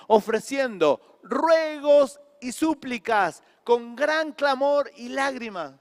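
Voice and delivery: loud, slow scripture read rising on ruegos; fervent preacher's reading; full-force preaching. Acted. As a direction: proclaim the verse to the whole church.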